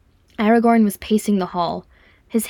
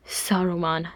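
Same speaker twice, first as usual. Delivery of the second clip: softer, breathed realization; 6 dB quieter than usual. A narrator's reading. Thorin naming who is behind the attack, breathed. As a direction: let the name out under your breath. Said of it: "breathed Thorin".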